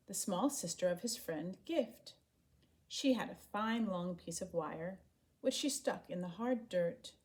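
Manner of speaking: ordinary delivery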